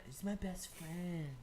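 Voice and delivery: mock the sappy phrase, slow and soft; drawn-out sappy imitation; mocking quote. Mocks a cutesy phrase he hates.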